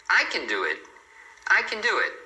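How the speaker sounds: can unstressed, its vowel swallowed; reduced can. Shown as a demonstration. Teacher models the affirmative sentence, can reduced. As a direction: pass lightly over can with a weak vowel. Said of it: "Ele é reduzido".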